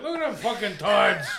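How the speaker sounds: high, loud mock Northerner jeer; mocking Yankee. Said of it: "all the Yankees were like".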